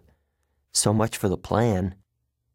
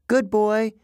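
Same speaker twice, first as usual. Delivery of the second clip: plain reading voice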